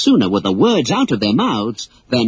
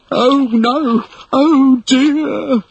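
much higher, louder fretful wail; moaning Smee; 13 semitones higher, 3 dB louder, much slower than usual. Acted. Smee seeing his captain sink, moaning in distress. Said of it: "moaned Smee".

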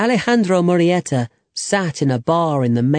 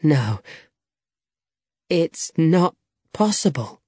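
halting, with long pauses between words; slowly; 2 semitones higher than usual. A narrator's reading. Young man doubts what the old man reveals, slowly. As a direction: let disbelief slow every word. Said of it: "he said slowly".

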